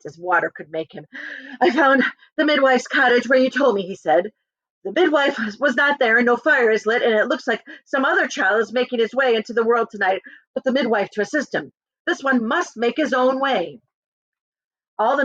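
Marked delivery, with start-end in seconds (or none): 1.6-3.8 raised servant report. Acted. Manservant reports. 4.8-13.8 high, breathless report; a lightly voiced manservant; servant's news. Manservant reporting no midwife, raised and urgent.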